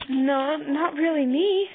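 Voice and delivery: high, slow, loud small voice; little stuffed kitty putting on courage; brave. A narrator's reading. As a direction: pipe up bravely like a tiny creature. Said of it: "said bravely".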